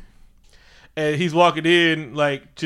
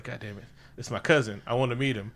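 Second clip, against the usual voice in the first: much softer, lower muttering; a hint of brooding T'Challa; quiet aside; 4 semitones lower, 8 dB quieter than usual. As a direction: grumble under your breath.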